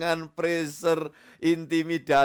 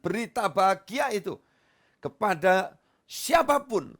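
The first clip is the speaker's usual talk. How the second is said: raised, fervent preaching voice; 7 semitones higher, 4 dB louder than usual. Acted. Pastor preaching to the church, fervent and raised. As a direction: preach it out to the congregation.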